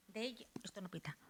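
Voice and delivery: very faint, unvoiced-sounding murmur; off-mic aside. Real, not acted. Presenter noting the microphone has stopped working, very quiet aside. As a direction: drop to a barely audible aside.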